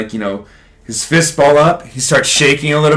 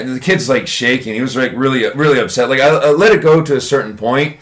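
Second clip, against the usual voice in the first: usual speaking voice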